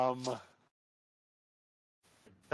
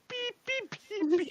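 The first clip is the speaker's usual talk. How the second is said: spoken morse code. Retold or imitated. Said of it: "The fox says".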